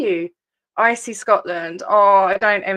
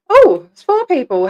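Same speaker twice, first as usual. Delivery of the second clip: high, loud surprised "oh"; pleased surprise; 7 semitones higher, 7 dB louder than usual. Real, not acted. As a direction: lift the "Oh" in glad surprise. Notices a few viewers have joined.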